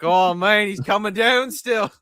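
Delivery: hammed-up Aussie worry; Australian accent. Hosts mock driver in Australian accent. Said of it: "He's Australian now".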